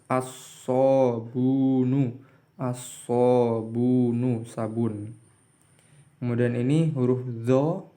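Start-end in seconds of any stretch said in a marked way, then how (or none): 0.1-4.4 "As-sobunu" said slowly and a bit louder, l merged into a doubled s; slow "as-sobunu" with the l dropped into the s; assimilated l demo. Shown as a demonstration. Teacher showing students the silent l, slow and louder. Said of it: "Huruf so tidak jelas".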